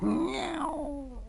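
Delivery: a very high, drawn-out cat meow; mournful meow. Acted. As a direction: cry like a sad old cat. Cat meows mid complaint.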